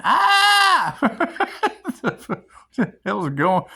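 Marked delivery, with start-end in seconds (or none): 0.0-1.0 high loud yelp imitating a hurt friend; mimicked scream of pain. Friend screaming as his mom pours peroxide on him, loud yelp. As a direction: cry out like disinfectant stinging a wound. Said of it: "he's screaming".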